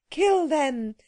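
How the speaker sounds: high regal command; queen crying out. A narrator's reading. The Queen orders her guards to kill, high commanding cry. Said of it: "cried the Queen".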